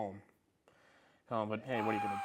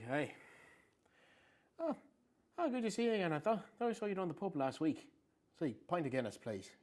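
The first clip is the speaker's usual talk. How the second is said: chatty Irish pub greeting, pitched higher; Irish accent; 7 semitones higher than usual. Retold or imitated. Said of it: "my accent was, like, so good".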